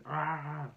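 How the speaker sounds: high, loud "ah"; drawn-out mock cry; imitated yell. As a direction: mimic brother making a daft loud noise. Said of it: "he was just going".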